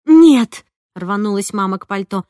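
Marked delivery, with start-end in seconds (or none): 0.1-0.7 sharp, much louder no; mother insisting she will go, loud refusal. A narrator's reading. Mother rushing to her coat.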